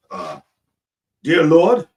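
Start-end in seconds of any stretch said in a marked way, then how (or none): 1.2-1.9 loud, high call to heaven; mock preacher's prayer. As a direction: open a mock prayer with a raised cry. Mock preacher starting a comic prayer.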